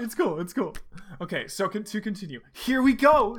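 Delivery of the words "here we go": high, loud launch call; acted speaker announcement. A narrator's reading. Tails sending Sonic onward by speaker, loud announcing voice. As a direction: project it as through a speaker. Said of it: "said Tails in his speaker".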